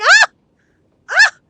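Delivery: short high yelp, re-enacted; two sharp mock screams; imitated yelp. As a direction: yelp twice, high and sudden, acting out the reaction. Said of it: "I was like".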